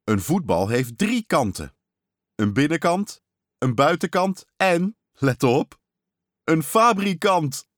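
playful father revealing a trick answer; triumphant punchline. Acted. Dad triumphantly reveals joke answer.

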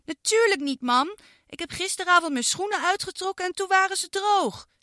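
put-on high childlike voice, indignant; a girl whining about wet socks; girl Iris complaining. Girl complains to her mum, high indignant child voice.

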